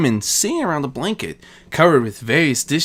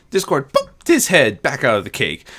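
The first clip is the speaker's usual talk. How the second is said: plain reading voice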